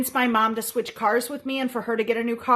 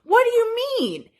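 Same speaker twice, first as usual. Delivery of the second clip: rising much higher in mock bewilderment; imitated shocked mom; 11 semitones higher than usual. Mom turning to the other daughter, imitated disbelief. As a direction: play mom thrown off. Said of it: "stopped and looked at Susie and goes".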